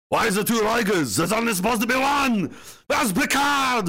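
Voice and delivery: high, shouted mock Austrian accent; hammed-up Austrian-accented outburst; Arnold Schwarzenegger. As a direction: bellow it indignantly in an Austrian accent. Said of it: "I associate him with Arnold Schwarzenegger".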